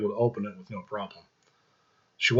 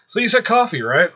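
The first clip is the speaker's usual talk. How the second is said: high, slightly louder nervous question; flustered change of subject; 11 semitones higher, 6 dB louder than usual. Acted. Angela stunned by Michael flying, flustered high question. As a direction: brush it off, voice jumping up. Angela dodging what she just saw.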